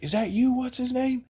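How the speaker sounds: high-pitched mock callout; retold husband. Host voices the husband confronting a man, high mock callout. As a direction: imitate a husband calling out, pitched well up.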